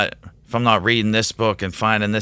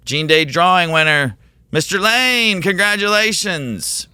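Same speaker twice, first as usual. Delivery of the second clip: much higher and louder, a grand self-announcement; mock announcer; 9 semitones higher, 3 dB louder than usual. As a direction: crown yourself the winner like a host. He announces himself as winner.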